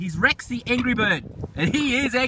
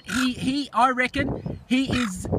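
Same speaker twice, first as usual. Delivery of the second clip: usual speaking voice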